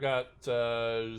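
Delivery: ordinary talk, nothing marked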